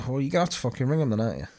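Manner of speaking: fast, irritated mock retort; rapid retort. Husband snapping at his wife over the wedding, fast and irritated. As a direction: rattle it off in exasperation. Retold angry row with wife.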